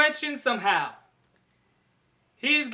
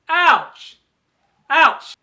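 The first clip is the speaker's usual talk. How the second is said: loud, short yelp of pain; pained cry; 6 dB louder than usual. Acted. Hero hit by android's punches, pained cry. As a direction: cry out as each punch lands.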